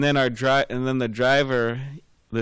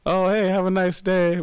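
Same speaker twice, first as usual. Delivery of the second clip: much higher, louder, beaming "Oh, hey, have a nice day"; California big smile; 8 semitones higher, 4 dB louder than usual. Retold or imitated. Teacher mimics a cheery Californian, bright and smiling. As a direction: sing it out with a big fake smile. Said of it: "big smile on their face".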